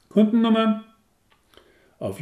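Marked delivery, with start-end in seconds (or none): 0.1-0.8 high, loud clipped question; curt clerk. Acted. Receptionist curtly asking the caller's customer number. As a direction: fire the question off briskly. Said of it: "als würde sie in einer Gurke knabbern".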